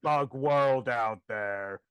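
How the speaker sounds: slow, loud menacing bug villain; Kevin Spacey. Acted. Hopper toy warning whoever enters the room, slow impression. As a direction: draw it out like a threat. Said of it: "problematic A F Kevin Spacey voice".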